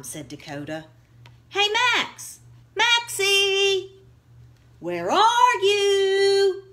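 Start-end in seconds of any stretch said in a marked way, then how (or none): 1.5-6.6 higher, loud sing-song call through the house. Acted. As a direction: call him from the next room. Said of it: "called Brody".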